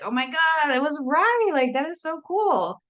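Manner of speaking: a thrilled gasp of being proven right; excited realization. Retold or imitated. Telling listeners how it feels to be right, excited. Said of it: "and be like".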